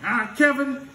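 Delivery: high voice threatening to call dad; teacher warning Kevin, pitched high; teacher scolding a student, high and loud. Retold or imitated.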